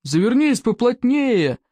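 high, slow shout from above. A narrator's reading. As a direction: call down from a flying dragon. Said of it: "крикнул спаситель Икингу сверху".

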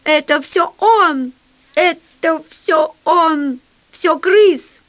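high, slow, drawn-out squeal; hammed-up panicky squeal, rising at the end. A narrator's reading. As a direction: squeal the accusation in a put-on high voice. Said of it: "визжа".

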